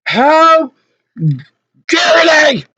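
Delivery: high, broken-up indignant shout; outraged. Real, not acted. Customer fuming over being threatened, outraged. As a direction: cry it out with a pause after the first word.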